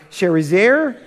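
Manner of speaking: "Sharezer" drawn out slowly as share-ee-ZAIR; another alternative pronunciation. Shown as a demonstration. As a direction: try "Sharezer" slowly in three syllables, ending on "zair". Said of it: "pick out whatever name".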